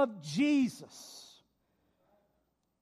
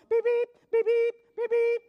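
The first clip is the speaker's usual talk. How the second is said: high, loud beep-beep of an alarm; watch beeping imitation; 13 semitones higher, 8 dB louder than usual.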